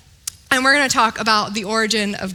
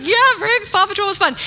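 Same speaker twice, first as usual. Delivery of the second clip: cheery mom; 8 semitones higher, faster than usual. Retold or imitated. Mom answering toddler cheerfully.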